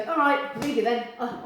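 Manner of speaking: hammed-up sulky goodbye; mock huffy exit. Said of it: "don't just go".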